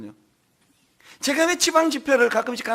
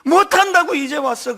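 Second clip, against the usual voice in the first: Jeolla dialect wail; 7 semitones higher, 8 dB louder than usual. Retold or imitated. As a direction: cry it out in thick Jeolla dialect. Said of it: "걸쭉한 전라도 사투리". (전라도 사투리로 통곡하듯)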